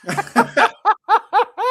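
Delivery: loud, high burst of laughter. Real, not acted. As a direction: crack up loudly. Laughing at own story.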